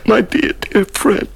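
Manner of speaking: crying. Acted. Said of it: "Do you know I'm crying again?".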